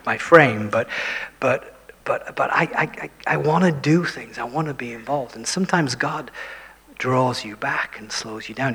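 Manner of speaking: ordinary talk, nothing marked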